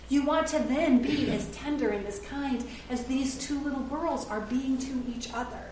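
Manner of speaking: usual speaking voice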